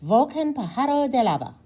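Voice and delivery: put-on "Volcan Pajaro de Lava" in a high, bright child's Spanish accent. A narrator's reading. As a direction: echo it in neat Spanish, a little girl showing off. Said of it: "Rue echoes with perfect little accent".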